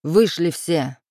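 short bossy order with a touch of anger; understated angry bark. A narrator's reading. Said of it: "рявкнул Алекс".

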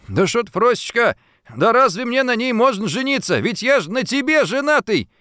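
high-pitched, playful protest in pretend alarm; mock fright. A narrator's reading. Husband protesting to his angry wife, mock fright. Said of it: "с притворным испугом отшатнулся".

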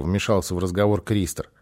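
ordinary delivery

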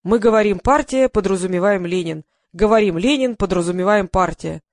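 loud verse with punched openings, falling endings; emphatic mock-solemn recitation; declaiming. A narrator's reading. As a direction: hammer out the slogan poem in balanced phrases. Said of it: "продекламировал мужчина за камерой".

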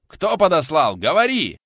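loud, high furious demand; enraged interrogation at full voice; yelling in his face. A narrator's reading. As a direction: roar the question at an attacker. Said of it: "в лицо буквально проорал пенсионер".

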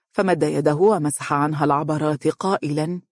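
plain reading voice

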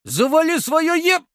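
high, loud furious snap; angry outburst. Acted. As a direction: start yelling back in rage. Angry retort cut off.

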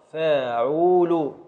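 فاعول stretched slowly, each syllable held; drawn-out metre word. Shown as a demonstration. Teacher showing the student the metre, drawn-out فاعول. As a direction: spell out فاعول syllable by syllable at a crawl. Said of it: "ابدأ في التقطيع".

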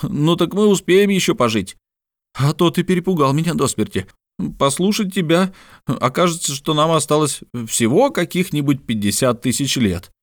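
high-pitched, lively mock reproach; animated relief. A narrator's reading. Ardan's excited relief after his outcry.

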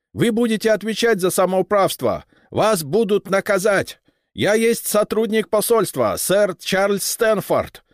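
put-on high, loud, nearly shouting with a foreign accent; a foreigner yelling in broken Russian; English accent Russian. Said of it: "как англичанин стал почти кричать". (английский акцент, почти крик)

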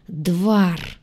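plain reading voice

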